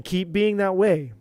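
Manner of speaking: ordinary talk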